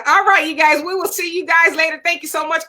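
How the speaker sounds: usual speaking voice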